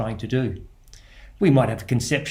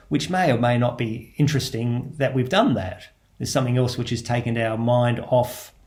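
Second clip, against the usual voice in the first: normal talking voice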